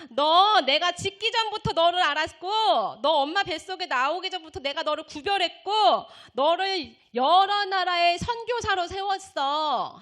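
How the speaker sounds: high, loud emphatic declaration; voice of God. Retold or imitated. God tells the student she was chosen, declaring loudly. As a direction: declare it forcefully as God, stretching key words. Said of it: "하나님이 이렇게 말씀하시는".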